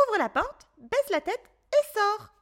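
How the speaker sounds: high rabbit voice, brisk instructions; rabbit giving orders. A narrator's reading. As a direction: direct the boy like a small commander. Rabbit voice in story.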